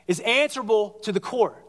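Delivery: usual speaking voice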